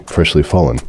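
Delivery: ordinary delivery, nothing marked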